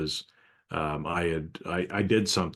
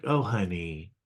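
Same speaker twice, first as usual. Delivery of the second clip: condescending honey. Retold or imitated. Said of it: "putting women down".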